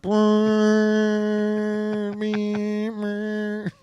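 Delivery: comic drawn-out vehicle noise; imitated motor drone. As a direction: buzz along on one held pitch. Imitating a noise after Pokemon joke.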